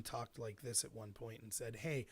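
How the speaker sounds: low, soft, measured delivery; normal unhurried pace. Shown as a demonstration. Debater showing students the original speed, unhurried. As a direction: keep it even and unhurried, pitch held down. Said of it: "but about at this speed".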